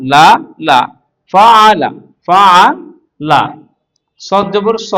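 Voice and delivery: loud, measured syllable chant; teacher leading a spelling drill; recitation drill. Shown as a demonstration. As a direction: sound out each syllable firmly, holding the long vowels. Spelling drill for Quran reading.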